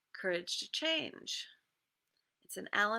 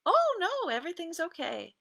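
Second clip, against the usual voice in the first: bright fake-cheerful denial, higher; chirpy "everything's fine" through clenched teeth; 9 semitones higher, 5 dB louder than usual. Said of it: "With clenched teeth, I painted on a smile".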